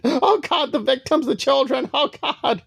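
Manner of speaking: high falsetto mock sobbing parody; mock crying Ellie. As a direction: ham up a weepy overwrought victim. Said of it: "She wanna do this whole weirdo tangent".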